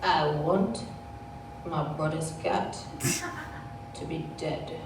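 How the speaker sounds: halting, chilling delivery, phrase by phrase; menacing little boy. Retold or imitated. Four-year-old telling his mother his dark wish, mock-menacing.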